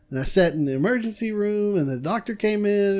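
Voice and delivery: ordinary talk, nothing marked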